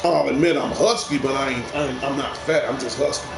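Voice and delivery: ordinary talk, nothing marked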